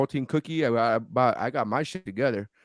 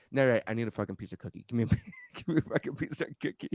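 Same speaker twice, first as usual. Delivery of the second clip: mock desperate friend; 7 dB quieter, faster than usual. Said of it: "she's like".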